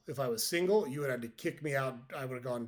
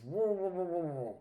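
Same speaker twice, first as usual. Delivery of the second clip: repeated mumble through a full mouth, higher and louder at the start; louder mouthful mumble; 6 semitones higher than usual. Retold or imitated. Fisherman with worms in his mouth repeats himself, mumbling louder. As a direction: push the mumble harder so he can be understood. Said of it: "The guy says".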